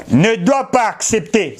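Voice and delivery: usual speaking voice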